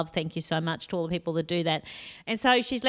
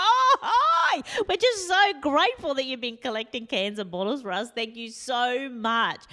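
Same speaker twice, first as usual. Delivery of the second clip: high, effusive greeting, easing down; exaggerated sing-song thankfulness; gushing thanks; 5 semitones higher than usual. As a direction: overdo the gratitude for the lady.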